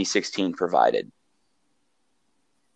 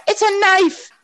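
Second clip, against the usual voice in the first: put-on kid voice; slower than usual. Imitating kid in video.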